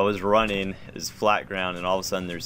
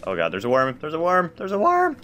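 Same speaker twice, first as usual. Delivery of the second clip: panicked shriek climbing ever higher; scared horror game streamer; 10 semitones higher, 5 dB louder than usual. Real, not acted. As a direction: scream it louder and higher each time. Chased by a worm in game.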